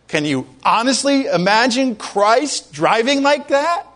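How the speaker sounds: ordinary delivery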